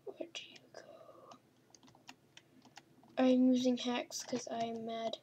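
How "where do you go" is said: creepy whisper. Pretend. Gamer whispering as the creature. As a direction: ghostly whisper.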